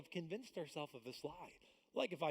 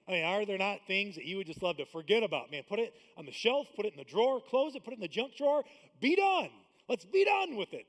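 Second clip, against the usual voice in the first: rising, pleading louder and higher; urgent loud plea; 5 semitones higher, 11 dB louder than usual. Acted. Building preacher's cry to the congregation. Preacher urging to forget problems.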